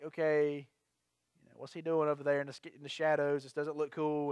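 low, quiet thinking to yourself; wary inner thought. Retold or imitated. Man eyeing a lurker at the gas station, low and wary. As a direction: murmur a suspicion under your breath.

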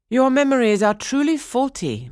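high, loud, firm reproof; chiding fairy. A narrator's reading. Lilac fairy corrects the king's memory, chiding. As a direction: scold from on high.

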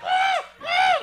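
very high whistle blasts; teacher's whistle. Retold or imitated. Yard teacher blowing her whistle, high whistle sounds. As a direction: imitate a shrill whistle. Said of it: "Stupid whistle".

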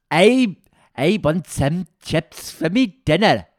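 high, loud, slow Welsh accent; hammed-up Welsh accent, pitched high and shouted. Shown as a demonstration. Dialect coach demonstrates poor Welsh mindset, caricature Welsh accent. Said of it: "you need to sound poor".

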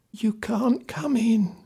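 put-on high, slow whisper of an old woman; a scared old woman's breathy hush at the door. A narrator's reading. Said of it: "she whispered".

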